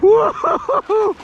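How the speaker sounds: long high whoop; loud, high-pitched celebratory woo-hoo-hoo; whooping cheer. Real, not acted. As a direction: let out a long, joyful whoop. Cheering while fighting big fish.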